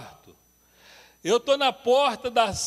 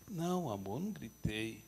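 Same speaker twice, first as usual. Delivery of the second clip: meek denial; 9 semitones lower, 12 dB quieter than usual. Retold or imitated. Husband meekly denying shouting.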